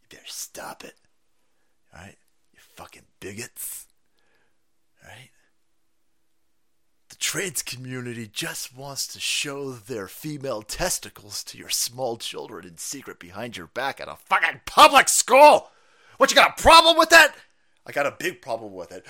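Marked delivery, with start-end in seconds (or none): 0.0-5.3 menacing whisper, very soft; mock whisper. Mocking activists' threat, whispered. 14.2-17.3 loud high yelling; mock shout. Mocking an activist, shouting at parents.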